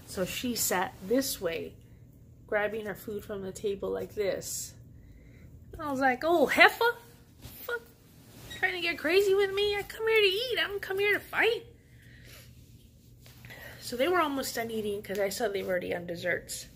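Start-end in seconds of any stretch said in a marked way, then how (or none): 6.2-11.6 high, loud, outraged tirade; furious outburst. Retold or imitated. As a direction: tell off the rude lady at full volume. Confronting the napkin-stealing lady.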